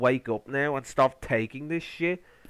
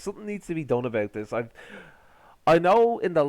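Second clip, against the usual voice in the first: ordinary delivery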